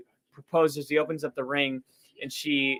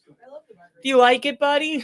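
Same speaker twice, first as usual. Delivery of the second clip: high, loud, slow dog-talk voice; 10 semitones higher, 6 dB louder, slower than usual. Acted. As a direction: coo it up high like praising your dog. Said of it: "sound like he's talking to his dog".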